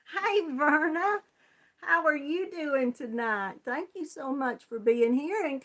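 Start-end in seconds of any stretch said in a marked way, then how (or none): none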